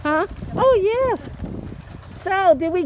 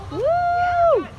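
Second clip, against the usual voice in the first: excited cheer; 12 semitones higher than usual. Real, not acted. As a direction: let out a big celebratory woo. Cheering birthday parade car.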